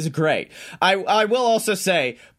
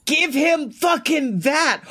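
excited outburst; 7 semitones higher than usual. Real, not acted. Podcaster fired up about a new belt, excited outburst. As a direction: blurt it out, all fired up.

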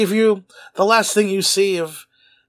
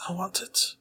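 unvoiced whisper of the wish; 12 dB quieter than usual. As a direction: breathe it out as a whisper. Repeated line whispered.

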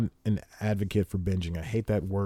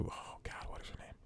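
barely audible mutter to self; whispered self-questioning; 23 dB quieter than usual. Real, not acted. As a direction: whisper it while searching your memory. Asks self while forgetting a name.

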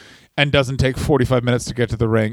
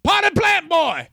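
much higher, slow jeering shout; prisoners jeering; 14 semitones higher, much slower than usual. Retold or imitated. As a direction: taunt him down the row like a mob of inmates. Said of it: "all of them are just being like".

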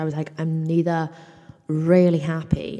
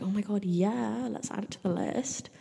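mock delight; 6 semitones higher, 4 dB quieter than usual. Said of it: "No, I'm joking".

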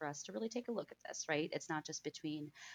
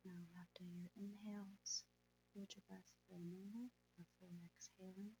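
soft spa whisper; 8 dB quieter than usual. Acted. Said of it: "let's go ahead and meditate".

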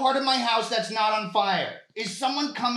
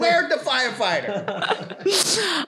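loud, laughing mock outrage; laughing fake outrage; 6 semitones higher, 8 dB louder than usual. Caller pretending to be insulted by "dispatch", loud and laughing. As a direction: act offended while cracking up.

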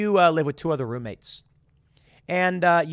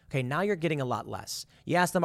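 ordinary talk, nothing marked

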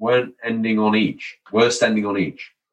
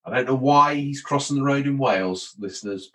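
ordinary talk, nothing marked

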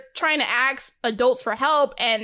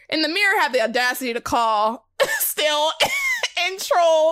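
laughing; 5 semitones higher than usual. Real, not acted. Laughing at mirror trolling.

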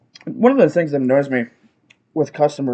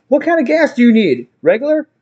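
raised, higher-pitched question; insistent repeat; 6 semitones higher than usual. Retold or imitated. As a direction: push the question through the headphones. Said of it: "I just keep getting louder".

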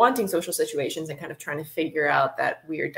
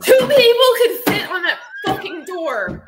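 mock outrage; 12 semitones higher, 9 dB louder, slower than usual.

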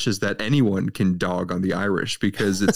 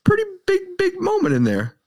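excited protest. Real, not acted. Insisting there is a big moment.

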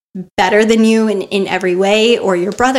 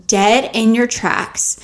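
usual speaking voice

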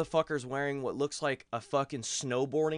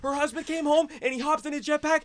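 high, loud, rushing faster at the end; panicked; 13 semitones higher, 6 dB louder than usual. Retold or imitated. Telling Shane on the phone he is scared, high panicked rush. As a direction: babble it out like you are sure you are being hunted.

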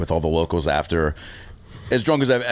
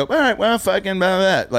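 much higher, throwaway giving-up tone; dismissive bail; 11 semitones higher than usual. Shown as a demonstration. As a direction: shrug off the bit mid-sentence. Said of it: "don't just go".

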